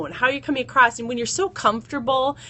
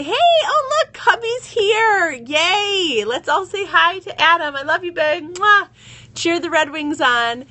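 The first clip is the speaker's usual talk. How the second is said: high, loud cheery hello to hubby; bubbly sing-song greeting to the husband walking in; excited greeting; 7 semitones higher, 4 dB louder than usual. Real, not acted. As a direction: welcome him home with a real burst of delight. Greeting husband arriving live.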